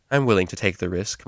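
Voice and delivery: usual speaking voice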